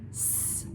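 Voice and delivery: "S." a voiceless hiss, no vowel; just the hiss of "S."; isolated s sound. Shown as a demonstration. Teacher naming the first sound of sod, hissed "S.". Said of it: "What are the rest of the sounds?".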